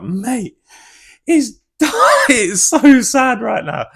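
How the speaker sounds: high, loud hammed-up lament; mock wailing. Fan reacting to the heartbreak scene, mock wailing. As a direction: wail it, drawing the words out with a laugh. Acting out being upset at film.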